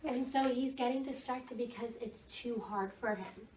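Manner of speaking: usual speaking voice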